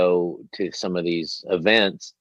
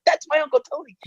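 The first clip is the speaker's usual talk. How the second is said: put-on proud kid. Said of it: "I was like".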